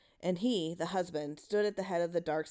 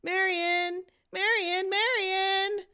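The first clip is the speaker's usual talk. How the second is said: much higher, louder repeated call; 13 semitones higher, 6 dB louder, slower than usual. A narrator's reading. Boy calling for Marion around the house, loud.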